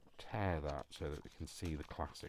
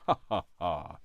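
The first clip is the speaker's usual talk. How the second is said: 1 mock laugh; 9 dB louder than usual. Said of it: "he says".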